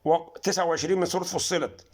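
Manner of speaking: usual speaking voice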